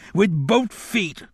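high, slow tearful cry; weeping vow. A narrator's reading. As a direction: draw the vow out in a tearful pitch. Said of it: "Johnson wept".

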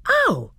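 high, sudden pained yelp; sharp yelp. Acted. Edmund yelps as the magic pulls him. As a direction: yelp as if stung. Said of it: "made a noise like".

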